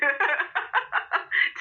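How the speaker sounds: high cackle at own joke; high laugh. Real, not acted.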